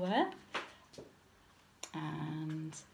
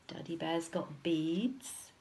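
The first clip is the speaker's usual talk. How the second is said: ordinary delivery, nothing marked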